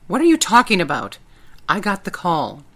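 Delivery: loud, indignant outburst; infuriated. Acted. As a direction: push back hard and loud.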